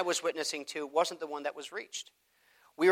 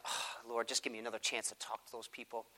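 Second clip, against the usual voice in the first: quiet prayer; 6 semitones lower, 16 dB quieter than usual. Retold or imitated. Silent prayer after being snubbed.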